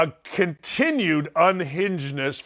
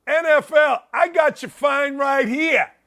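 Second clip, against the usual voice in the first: higher-pitched, defiant coach calling out the league; brash mock challenge, pitched up; Sean Payton; 6 semitones higher than usual. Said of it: "good for Sean Payton for saying".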